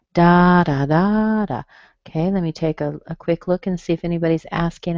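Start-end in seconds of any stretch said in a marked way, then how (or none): 0.1-1.6 "Da, da, da, da" as DA-da-DAA-da; stress pattern demo. Shown as a demonstration. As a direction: beat out first and third syllables long in "Da, da, da, da". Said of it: "strong on the lie".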